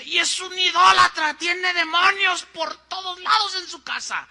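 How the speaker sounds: high, loud, fiery pulpit denunciation; fiery preaching. Retold or imitated. Pastor preaching against his host's house, high loud shout. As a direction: thunder against the idolater from the pulpit. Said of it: "¿Qué crees que predicó el pastor?".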